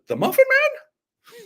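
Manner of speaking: very high, slow, incredulous squeal; drawn-out high-pitched mock question; movie-line impression. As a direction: squeal the question like a cartoon character. Quotes a famous film line.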